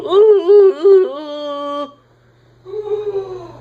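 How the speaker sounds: very high falsetto "ooh" wail; gums going "ooh". Acted. Gums reacting to dip in your mouth, high falsetto oohing. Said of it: "your gums go".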